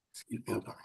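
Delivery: barely holding it together, near tears; steady voice with shaky moments. Real, not acted. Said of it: "I get a little emotional on this".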